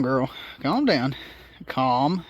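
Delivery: normal talking voice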